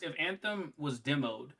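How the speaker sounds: ordinary talk, nothing marked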